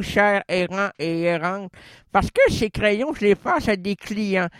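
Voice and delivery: ordinary talk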